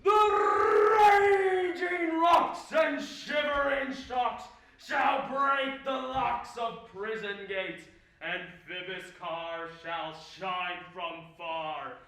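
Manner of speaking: slow, booming Ercles rant; lofty ranting tyrant. Acted. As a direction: declaim it like a raging tyrant. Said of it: "oh, this was lofty".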